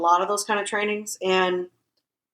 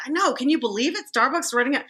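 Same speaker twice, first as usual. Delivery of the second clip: much higher, eager sympathetic pitch; friendly stranger; 7 semitones higher than usual. Retold or imitated. Stranger calming angry customer in Starbucks, upbeat. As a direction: win him over with upbeat sympathy. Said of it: "He's like".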